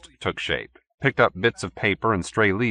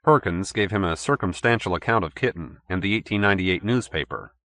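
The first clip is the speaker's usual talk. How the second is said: usual speaking voice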